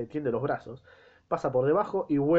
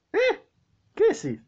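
high, loud startled squawk; baffled outburst; 5 dB louder than usual. Acted. Doctor thrown by the mute girl's grunts, high and loud. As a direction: jump up in disbelief.

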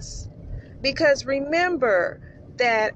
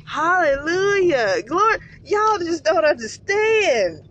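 joyful praise; 6 semitones higher, 4 dB louder than usual. Real, not acted. Worshipper overcome with joy.

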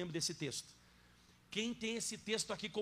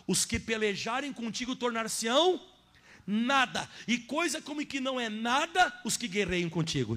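loud, higher, slowed emphatic preaching; preaching at full force; 4 semitones higher, 7 dB louder than usual. Acted. Pastor to congregation, loud fervent preaching. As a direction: hammer each promise home.

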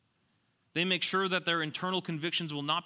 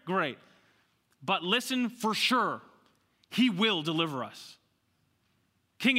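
emphatic, raised preaching voice; full-force preaching; 6 semitones higher, 6 dB louder than usual. Real, not acted. Preacher voicing the men's defiance to the king, full-force preaching. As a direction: drive home CAN and WILL.

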